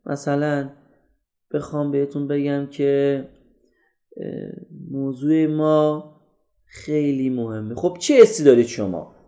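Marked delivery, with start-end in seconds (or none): 0.1-7.8 slow, soft, sleepy delivery. Shown as a demonstration. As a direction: drag it out quietly with long pauses. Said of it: "این انرژی کم من".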